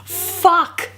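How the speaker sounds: a high, hammed-up inner scream; mock scream. Jacob screaming inside his head, hammed-up high shriek. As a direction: shriek it as Jacob's silent panic. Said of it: "Jacob screamed in his head".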